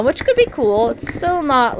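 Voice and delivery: ordinary talk, nothing marked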